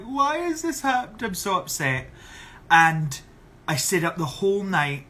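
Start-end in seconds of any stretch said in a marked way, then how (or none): 0.0-2.1 high, hammed-up lament; mock-distraught, pitched up then settling; retold upset wail. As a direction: imitate wailing to friends, easing down at the end. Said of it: "stayed up all night really upset".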